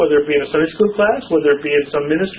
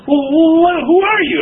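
flustered surprise; 10 semitones higher, 4 dB louder than usual. Retold or imitated. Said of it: "They go".

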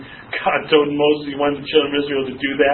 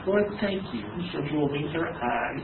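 hushed, much softer aside; muttered private prayer; 10 dB quieter than usual. Retold or imitated. Pastor privately thanking God the critic left, hushed and wry. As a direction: say a wry little thanks under your breath.

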